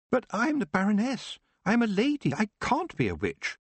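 put-on high, prim young lady voice; a noblewoman refusing with shocked dignity; young Baroness. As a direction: protest like a proud young noblewoman. Baroness Letitia protests.

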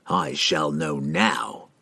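slow, low menacing growl; cold, deliberate villain's growl. A narrator's reading. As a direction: draw it out in a restrained growl. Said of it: "Entreri growled".

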